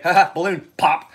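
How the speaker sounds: a loud mock taunt from some guy in the crowd; mocking knife guy. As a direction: mimic a dude popping a balloon for a laugh. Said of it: "One dude's gonna be like".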